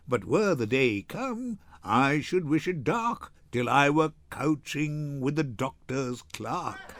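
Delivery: usual speaking voice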